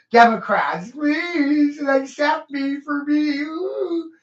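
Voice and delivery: high, slow whiny plea ending in a fake sob; mock pleading. Mocking people who crave approval, high whiny plea. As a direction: beg like a pitiful wannabe.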